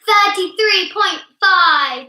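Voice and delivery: loud, high, drawn-out announcement; calling out scores. Real, not acted. Child quizmaster calling a score to the players, loud announcing. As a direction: announce it slowly at full voice.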